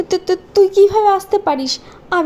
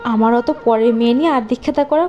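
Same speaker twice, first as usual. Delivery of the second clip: low, sneering; sneering at her husband; scornful stepmother; 8 semitones lower than usual. Acted. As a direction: scoff at the fuss.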